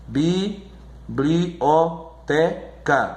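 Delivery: very slow bi-bli-o-te-ca with gaps; syllable by syllable. Shown as a demonstration. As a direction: pause after each syllable of "Biblioteca". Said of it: "você divide a sílaba".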